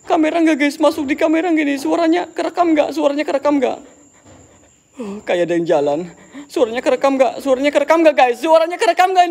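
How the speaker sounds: rattled and rushing; agitated vlogger shouting to the camera; excited panic. Real, not acted. Panicked checking recording, calling viewers.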